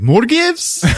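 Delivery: very high mock-astonished question; incredulous squeak. Mocking disbelief at more gifts.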